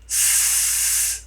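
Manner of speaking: a long hissed S with no voice; voiceless hiss. Shown as a demonstration. Teacher showing students a voiceless S. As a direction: hold a voiceless S sound. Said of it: "Faz s e bota a mão na garganta aqui".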